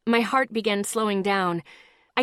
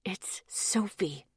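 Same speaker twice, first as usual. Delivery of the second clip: slow, tense whisper through teeth; whisper through clenched teeth; 8 dB quieter, slower than usual. A narrator's reading. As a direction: hiss it through gritted teeth. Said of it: "I said through clenched teeth".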